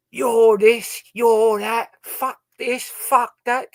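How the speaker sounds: high, loud mock rant at a host; hammed-up insults at the door; mock rude visitor. Guest imitating a rude visitor, high mock rant. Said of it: "you don't go in there and go".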